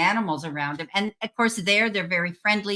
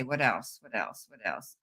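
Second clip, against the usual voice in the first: muttered to herself; 9 semitones lower, 9 dB quieter than usual. Real, not acted.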